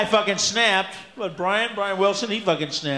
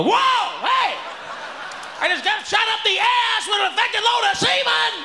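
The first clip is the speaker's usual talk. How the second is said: mock shocked victim. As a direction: yelp like a man ambushed on the toilet. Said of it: "sit on a toilet seat and go".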